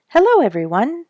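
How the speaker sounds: high, loud bright chirp of hello; chirped greeting. A narrator's reading. Bird calls hello to old friends, chirping. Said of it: "chirped Mister Bluebird".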